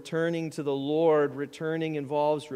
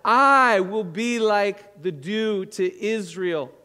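slow, louder, "I" hit; emphatic quote; 6 dB louder, slower than usual. Shown as a demonstration. Preacher reading verse five, emphatic and slow. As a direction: proclaim it with weight on "I". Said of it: "Verse five".

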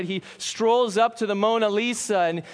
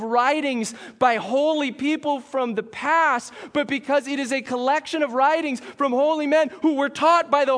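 higher, insistent preacher's build; impassioned preaching; 4 semitones higher than usual. Real, not acted. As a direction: preach it with mounting urgency, leaning on the big words. Preaches with rising force.

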